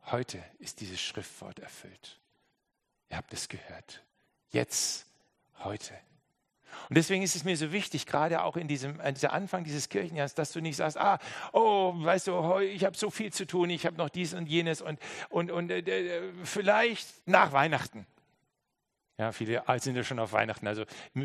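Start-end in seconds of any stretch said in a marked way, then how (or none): 0.1-5.9 hushed Jesus quote. Acted. Jesus' Worte leise zitiert. 11.0-18.1 higher, flustered stammering excuse; mock busy excuse. Ausreden der Zuhörer nachgeäfft.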